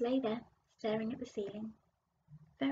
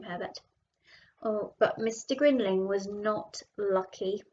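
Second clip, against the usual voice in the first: plain reading voice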